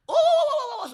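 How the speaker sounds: loud, high monkey howl; crazed monkey. Acted. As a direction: let out a wild monkey hoot. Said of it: "so he's going crazy".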